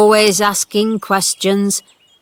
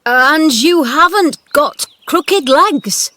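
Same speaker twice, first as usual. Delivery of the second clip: shaky voice; 8 semitones higher, 8 dB louder, slower than usual. A narrator's reading. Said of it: "Ben said in a shaky voice".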